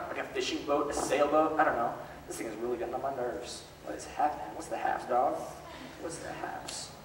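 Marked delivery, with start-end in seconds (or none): 4.5-6.9 whispered aside, barely voiced. Real, not acted. Teacher whispering to a restless kid.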